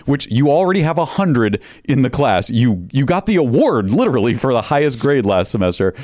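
ordinary talk, nothing marked

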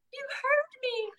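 high, hushed little voice; amazed child. Retold or imitated. Preschooler realizing mom listened, high and hushed. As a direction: breathe it out like a stunned toddler. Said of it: "they will be like".

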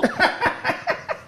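high, breathy burst of laughter. Real, not acted. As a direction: let a high airy laugh break out. Laughs at own sarcasm.

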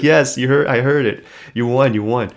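loud retold attendant giving in; emphatic concession. Attendant telling the player he won, loud and insistent. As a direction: concede loudly, insisting it was heard.

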